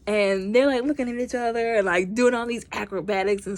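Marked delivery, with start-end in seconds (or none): none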